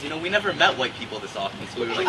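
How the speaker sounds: ordinary talk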